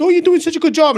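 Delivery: fake-nice pat on the head; mock sugarcoating. Cohost mocks the praise he does not want, sugary and high. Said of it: "sugarcoat me and be like".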